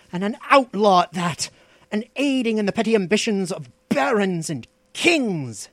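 proud sorcerer lamenting his fall, pitch surging; bitter. A narrator's reading. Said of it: "he said with unconcealed bitterness".

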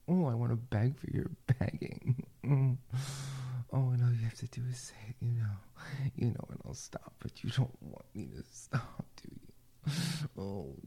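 ordinary talk

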